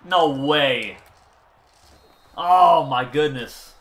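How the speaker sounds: loud, stretched-out groan of disbelief; loud dismay. Real, not acted. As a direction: cry out slowly in disbelief. Dropped pass heartbreak.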